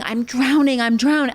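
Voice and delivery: panicked yell. Retold or imitated. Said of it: "People were screaming".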